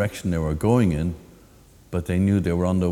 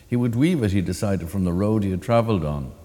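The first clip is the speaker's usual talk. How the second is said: ordinary delivery, nothing marked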